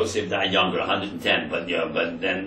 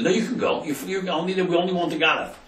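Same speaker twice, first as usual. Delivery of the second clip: high-pitched mock official dismissing the brothers; gruff officer; 10 semitones higher than usual. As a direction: play the officer waving them away. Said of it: "He says".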